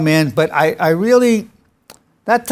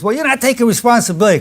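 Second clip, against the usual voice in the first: quick, higher-pitched imitated reproach; a little heated; 6 semitones higher, faster than usual. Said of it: "got a little heated".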